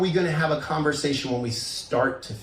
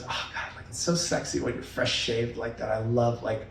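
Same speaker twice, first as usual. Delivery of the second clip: put-on admiring girlfriend; 5 semitones lower than usual. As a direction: imitate a partner purring approval, hushed and lower. Girlfriend admiring his fresh shave.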